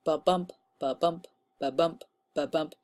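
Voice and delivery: softly tapping out ba-bump like hoofbeats; hoofbeat rhythm. Shown as a demonstration. Teacher showing the class the rhythm, a soft hoofbeat chant. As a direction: chant the ba-bump as a steady horse's gait. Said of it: "almost like horse's footsteps".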